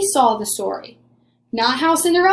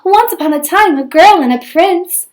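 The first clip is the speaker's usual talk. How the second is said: higher, louder storybook lilt; storytelling girl; 6 semitones higher, 6 dB louder than usual. A narrator's reading. Cindy starts telling another story, storybook lilt. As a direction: launch into a fairy tale brightly. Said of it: "said Cindy".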